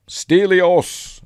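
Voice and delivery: high, loud drawn-out call; retold shout. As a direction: shout the name out in imitation. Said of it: "Then he shouts".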